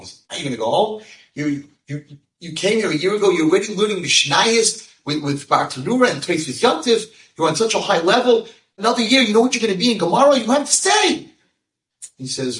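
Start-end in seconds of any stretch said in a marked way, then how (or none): 0.3-11.3 building from plain to high, fast, loud urging; urging excitedly. Acted. Rav Noach urging the priest to stay, rising excitement. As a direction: pile on the enthusiasm until it peaks.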